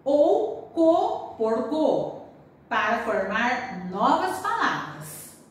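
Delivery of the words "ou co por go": slow teaching emphasis. Shown as a demonstration. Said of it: "Troque as sílabas K por G".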